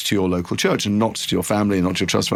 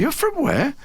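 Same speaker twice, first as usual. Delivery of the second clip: pitched far higher, an incredulous "You're from where?"; surprised disbelief; slower than usual. Retold or imitated. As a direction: retell your own stunned double take. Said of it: "And I went".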